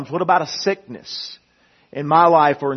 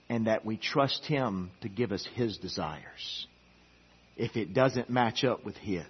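much softer, lower appeal; soft earnest preaching; 3 semitones lower, 8 dB quieter than usual. Acted. Preacher urging surrender on the church, softly. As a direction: draw them in quietly.

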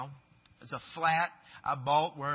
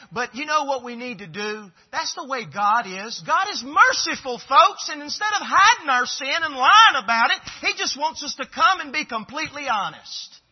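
higher, much louder pulpit preaching; a preacher urging the congregation toward honesty; fiery preaching; 9 semitones higher, 11 dB louder than usual. Acted. Preaching at full force.

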